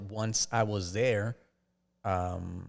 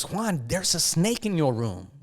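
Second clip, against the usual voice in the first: a touch higher, alarmed mother; lightly voiced mom warning about the snake; mom's alarmed voice; 7 semitones higher, 4 dB louder than usual. Mom announces snake, retold.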